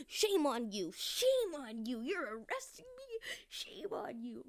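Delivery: high, loud mock outrage; mocking indignant woman; mock outraged woman. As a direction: ham up her indignant cry.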